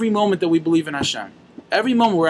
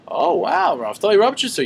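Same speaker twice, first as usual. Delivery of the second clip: higher-pitched mock awe; acted impressed surprise; 5 semitones higher than usual. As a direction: play an angel impressed by the name. Angel impressed by the chassid's rebbe.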